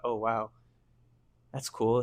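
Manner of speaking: normal talking voice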